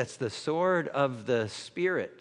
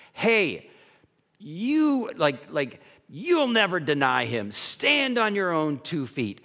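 hammed-up, louder pep talk; mock bravado; 3 semitones higher, 4 dB louder, slower than usual. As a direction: pump them up like a cheerleader.

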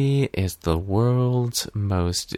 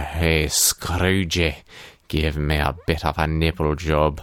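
Scrooge; 6 semitones lower than usual. Pretend. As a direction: coax yourself in a low Scottish voice. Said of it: "giving himself a pep talk in the mirror".

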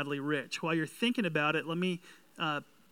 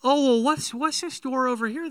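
amazed; 8 semitones higher, 5 dB louder than usual. Acted. Jesus' family voiced, amazed.

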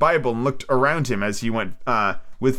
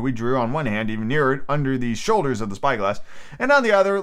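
usual speaking voice

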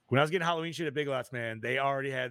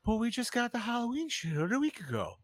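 higher, incredulous whine imitated; mock exasperated protest; 9 semitones higher than usual. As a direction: play the baffled retail worker. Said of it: "I'm like".

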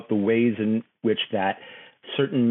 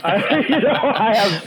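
laughing; 10 semitones higher, 5 dB louder, slower than usual. Real, not acted. Laughing while answering.

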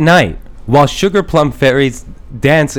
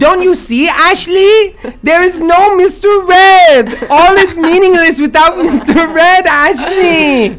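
ghost voice; 13 semitones higher, 4 dB louder than usual. Acted. Christmas lady speaks.

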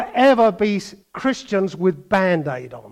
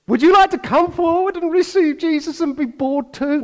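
higher, sing-song preacher invitation; mock altar call; 9 semitones higher than usual. Preacher mocks a church altar call, higher sing-song. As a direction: sweetly invite them to boredom.

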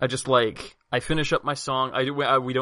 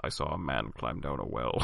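much lower, soft broken English; low gruff character voice; 11 semitones lower, 8 dB quieter than usual. Acted. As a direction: keep the low brute voice. Bard's character speaks.

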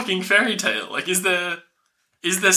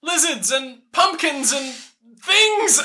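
flustered high excuse; 9 semitones higher, slower than usual. Retold or imitated. Fleeing princess to prince, flustered rising squeak. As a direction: pitch climbing up into a flustered squeak. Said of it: "And she's like".

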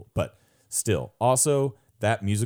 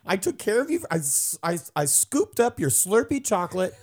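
high-pitched hurt whine; mock-hurt high whine about the gumbo; whiny protest; 13 semitones higher than usual. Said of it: "was like".

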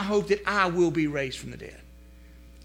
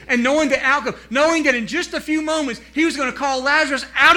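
louder, higher, eager build; excited preaching; 6 semitones higher, 8 dB louder than usual. Acted. Preacher marvelling at Lazarus coming out, excited. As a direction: lift up with joyful energy.

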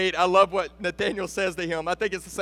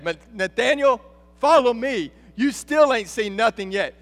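loud, raised climax of a sermon; impassioned preaching; 3 semitones higher, 4 dB louder than usual. Acted. Jesus telling Nathanael of the cross, impassioned. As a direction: proclaim it with mounting force.

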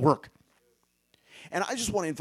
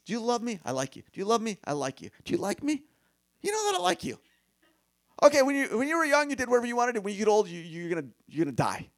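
higher, animated back-and-forth voices; retold Jesus conversation; 7 semitones higher, 4 dB louder, faster than usual. Preacher acting out Jesus and Peter's exchange, higher and animated. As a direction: playfully act out both sides. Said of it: "Jesus just switched channels".

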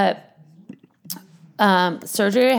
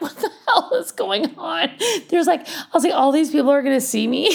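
laughing disbelief; 8 semitones higher than usual. Retold or imitated. Surprised by a crowded operating room.

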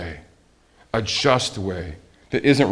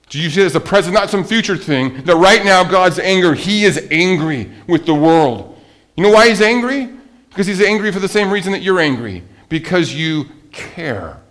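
higher and louder, urgent pulpit voice; preaching; 7 semitones higher, 6 dB louder than usual. Acted. Preacher to congregation, insisting God is angry now, at full force. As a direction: drive home that God is angry.

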